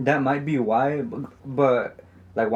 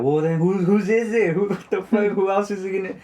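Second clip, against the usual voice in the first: high-pitched, indignant retold back-talk; frustrated back-talk; 8 semitones higher than usual. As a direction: imitate a sister snapping back at mom, voice raised in pitch. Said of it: "like, aggressively when, when she gets frustrated".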